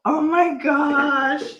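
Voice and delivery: high, drawn-out cry of amazement; overwhelmed exclamation. Real, not acted. Collector fighting tears over a gift, overwhelmed exclamation. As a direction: stretch it out high, overcome by the gift.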